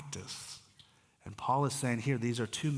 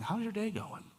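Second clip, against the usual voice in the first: friendly greeting; 9 semitones higher, 3 dB quieter than usual. Retold or imitated.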